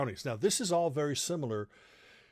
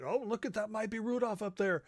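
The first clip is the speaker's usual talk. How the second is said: higher, hushed mock wonder; dad playing it up; 9 semitones higher than usual. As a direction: point at the sky like a dad spinning magic. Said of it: "it's just like".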